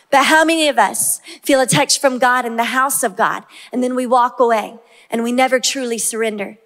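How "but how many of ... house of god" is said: ordinary delivery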